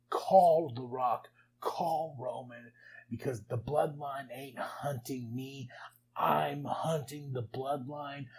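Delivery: slow, deliberate imitation of a wrestler's threat; retold defiant challenge. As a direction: space out each word like a challenge.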